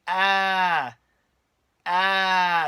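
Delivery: feeble, half-hearted "ah, ah" with no force; limp mock scream. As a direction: imitate a lazy, unconvincing scream. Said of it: "she starts, like, screaming".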